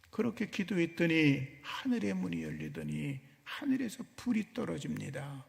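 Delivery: ordinary talk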